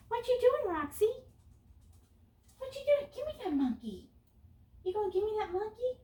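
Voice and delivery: very high, cooing dog voice; pet talk. Real, not acted. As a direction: coo playfully at your dog. Talking playfully to her dog.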